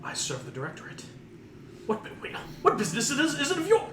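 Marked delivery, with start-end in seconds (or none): 1.9-3.9 flustered high fast skull; exasperated. Acted. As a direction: sputter like a professor caught off guard. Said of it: "he's very exasperated".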